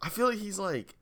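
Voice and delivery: high, worked-up incredulous rant; excited disbelief. Real, not acted. Shocked reaction to [name].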